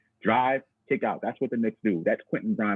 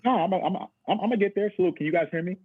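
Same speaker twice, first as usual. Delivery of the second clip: underwater; 8 semitones higher than usual. Real, not acted. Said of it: "you sound like you're underwater".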